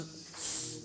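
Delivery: "S" as a long voiceless hiss. Shown as a demonstration. Phonetics teacher demos the voiceless S for the class, hissing. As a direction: hold "S" as a steady hiss with no voice. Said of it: "Go S and then Z".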